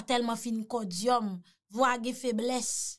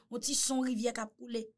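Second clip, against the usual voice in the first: ordinary delivery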